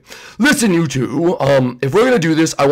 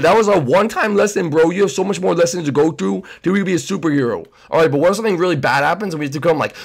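ordinary talk

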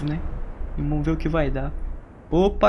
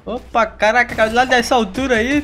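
louder, higher excited outburst; 5 semitones higher, 6 dB louder than usual. Real, not acted. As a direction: react loudly to the big fall. Inimigo cai de muito alto. (empolgado, mais alto e agudo)

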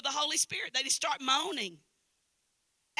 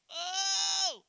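high held "oh", louder than the talk around it; imitated moan; 9 semitones higher, 5 dB louder than usual. Preacher imitating a woman moaning on stage, high cry. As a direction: imitate a singer's anointed moan. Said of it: "when you give out that".

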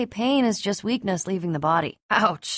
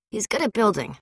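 normal talking voice

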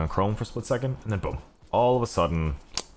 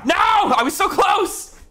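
high loud drawn-out shout of dismay; frustrated shout; 9 dB louder, slower than usual. Real, not acted. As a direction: yell at just missing it. Missed his guess of end time.

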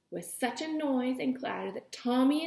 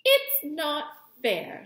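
stern; 5 semitones higher, 5 dB louder, much slower than usual. A narrator's reading.

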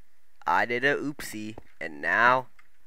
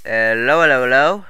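calling out; 3 semitones higher, 6 dB louder than usual. Real, not acted. Calling out to another player.

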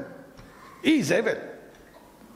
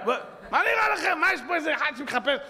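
outraged cry; 8 semitones higher than usual. Real, not acted. Outraged rhetorical question to crowd.

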